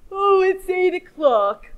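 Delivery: yawning elephant. A narrator's reading. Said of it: "yawned the elephant".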